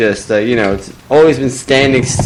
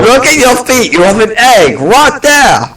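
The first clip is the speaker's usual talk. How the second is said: excited accusation; 9 semitones higher than usual. Acted. Owner accuses penguin.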